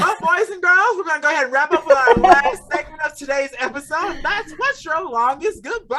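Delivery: loud, high-pitched show-host hype; over-the-top announcer yell; hollered announcer. Pretend. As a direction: shout it like a ringmaster introducing the last segment. Host hollers the segment intro.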